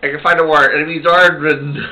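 normal talking voice

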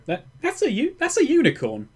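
acted wonder at a strange sight; in-character astonishment. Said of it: "nudge Senka and go".

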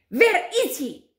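high, slow, loud demand; shouting witch. A narrator's reading. Grand High Witch searching the platform, shouting. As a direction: shout the question, drawn out and high. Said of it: "shouted the Grand High Witch".

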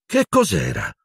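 sudden alarm at a noise. A narrator's reading. Matron hears a roar overhead, alarmed. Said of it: "chiese Madame Pomfrey allarmata".

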